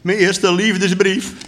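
high, slow, overcome voice; sniffly with emotion. A narrator's reading. Soldier moved by first love letter, sniffly and high. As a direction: stretch it out high, a little choked up. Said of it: "een beetje snofferig van alteratie".

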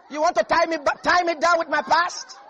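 loud defiant challenge, laughing; loud laughing defiance. Retold or imitated. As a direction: throw it back at them with a laugh. Voicing Rahab's defiance, loud.

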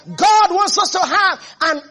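high, loud, driving sermon voice; impassioned preaching. Acted. Preacher rousing the church about a harvest of miracles, impassioned. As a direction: build to a ringing proclamation.

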